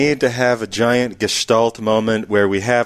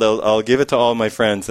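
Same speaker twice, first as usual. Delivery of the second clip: ordinary delivery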